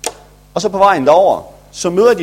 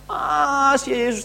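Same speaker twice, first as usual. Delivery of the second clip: insistent ah; 11 semitones higher, slower than usual. Acted. Jesus insists, retold story.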